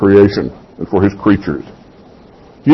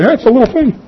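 high-pitched, offhand mock voice; Jesus, playfully paraphrased; 13 semitones higher, faster than usual. Preacher voicing Jesus making light of it, high and playful. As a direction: toss it off high, as a trifle. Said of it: "Jesus is saying".

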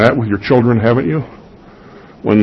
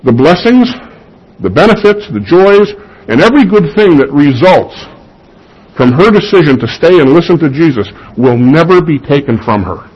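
building sermon; 5 semitones higher than usual. Acted. Rising preaching climax.

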